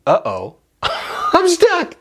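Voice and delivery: high laughing mock dismay; amused dismay. Climber realizing the tide has stranded him, amused dismay. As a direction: retell the dread through a laugh.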